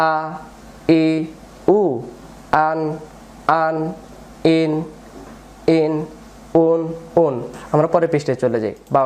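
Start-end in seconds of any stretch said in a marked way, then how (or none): 0.1-7.3 each syllable separate, with a pause after it; broken-up drill reading. Shown as a demonstration. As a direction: read out one sound at a time for learners to follow. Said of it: "ভেঙে ভেঙে পড়বো". (ভেঙে ভেঙে, থেমে থেমে উচ্চারণ)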